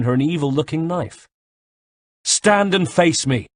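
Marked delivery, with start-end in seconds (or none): 2.2-3.5 slow, loud, high challenge; a hero's shouted dare to the enemy. A narrator's reading. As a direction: dare him to turn and fight. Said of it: "I shouted".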